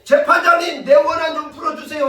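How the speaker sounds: high, loud cry to the judge; acted loud plea. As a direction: call out as the pleading widow. Acts out the widow pleading loudly.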